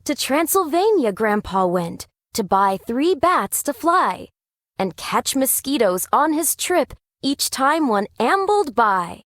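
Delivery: plain reading voice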